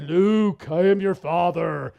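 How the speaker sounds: drawn-out mock movie-villain line; film villain quote. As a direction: intone it like a famous movie reveal. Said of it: "Star Wars Episode Five".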